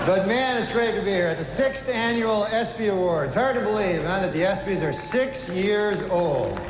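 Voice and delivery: loud, brash stand-up host working the crowd; awards host monologue. Acted. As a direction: play to the room like an awards show host. Stand-up host monologue, louder, announcer.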